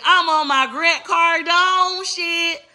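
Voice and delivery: stretched high bragging line, drawn out slowly; high slow boast. Acted. Boasting to her viewers in a high, slow drawl. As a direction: sing it high and slow like a hype mantra.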